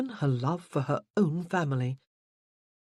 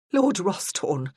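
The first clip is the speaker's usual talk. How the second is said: ordinary delivery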